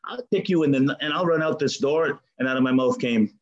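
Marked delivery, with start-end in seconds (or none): 0.0-2.2 cocky bluster. Retold or imitated. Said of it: "I said".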